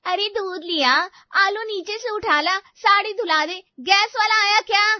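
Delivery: hammed-up mother-in-law calling orders; mocking mother-in-law. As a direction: parrot her bossy demands with resentment. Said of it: "तुम्हारी माँ की फरमाइशें शुरू हो जाती है".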